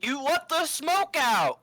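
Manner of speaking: high, loud, drawn-out wail; mock outcry. Player jokes as the glowing light fades, mock outcry. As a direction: cry it out slowly, high and loud.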